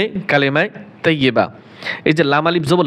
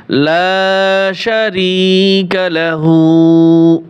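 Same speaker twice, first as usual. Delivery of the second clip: slow Quranic recitation; 4 dB louder, much slower than usual. Shown as a demonstration.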